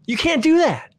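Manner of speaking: appalled at the threat; indignant protest. Retold or imitated.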